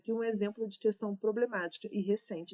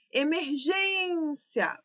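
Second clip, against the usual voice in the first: "Emergência" with GÊN stretched long and high; stressed syllable drawn out; 6 semitones higher, 3 dB louder, much slower than usual. Shown as a demonstration. Teacher hunts for the tonic syllable, "Emergência" with GÊN drawn out high. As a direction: call out "Emergência" slowly, e-mer-GÊÊÊN-cia. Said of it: "o gen é a nossa sílaba tônica".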